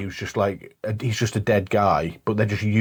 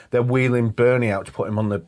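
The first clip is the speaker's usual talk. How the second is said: normal talking voice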